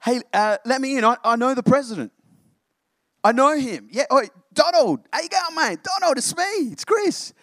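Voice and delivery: overly chummy, begging to be let in; mock desperate fan insisting he knows the president; eager name-dropper. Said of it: "and we'll be like".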